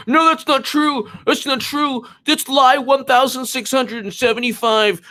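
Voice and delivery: high, whiny fact checker voice; sneering high pitched mimicry; high mocking voice. Mocking media fact checking Trump.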